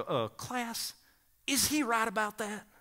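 put-on Southern accent, checking the class; Southern professor. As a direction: quiz the room like a Southern drill sergeant. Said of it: "thick Southern accent".

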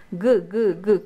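"g" as a repeated, isolated hard g sound; letter-sound demo. Shown as a demonstration. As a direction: sound out "g", not the letter name. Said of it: "¿Qué letra hace g".

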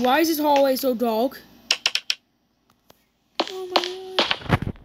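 usual speaking voice

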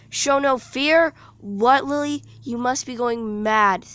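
loud, slow, high panicked shout; a frightened girl yelling at her calm friend; trembling yell. A narrator's reading. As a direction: yell it in disbelief, shaking with fear. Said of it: "yelled Elizabeth, trembling".

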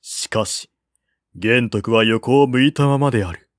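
plain reading voice

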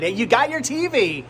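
usual speaking voice

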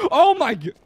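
gasping, high and loud fright; startled gasp. Real, not acted. Gasp at a scare mid-sentence.

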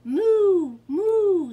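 loud, high, long-held moo; cow mooing. A narrator's reading. Reader voicing the cow for children, loud mooing. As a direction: moo it out at full voice. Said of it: "said the cow".

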